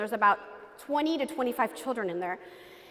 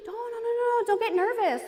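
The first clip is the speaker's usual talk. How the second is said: imitated reassuring doctor; 10 semitones higher than usual. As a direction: mimic a doctor hushing a nervous patient. Voices doctor soothing a shaking patient.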